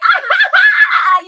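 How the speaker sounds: loud, very high, slow whooping laugh; stretched-out shrieking cackle of delight; big delighted laugh. Retold or imitated. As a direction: throw your head back and whoop with laughter. Retelling her delighted reaction.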